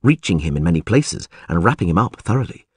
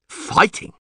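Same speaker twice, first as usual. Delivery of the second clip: hushed, voiceless disbelief; surprised. A narrator's reading. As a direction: echo it under your breath in surprise. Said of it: "Neville was surprised".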